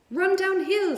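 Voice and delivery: high, loud urgent call; cows calling out. A narrator's reading. Cows calling advice to the fleeing pig. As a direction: holler advice from the pasture. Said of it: "suggested the cows".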